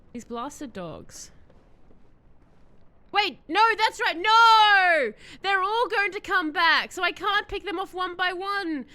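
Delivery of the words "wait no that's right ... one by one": high, loud, stretched "no!"; dismayed shout. Real, not acted. Streamer realising the dogs will all come back, dismayed. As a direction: cry out as the plan falls apart.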